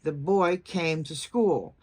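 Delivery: put-on slow, deliberate beats on "boy", "came", "school"; sentence stress. Shown as a demonstration. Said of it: "you'd emphasize boy, came, and school".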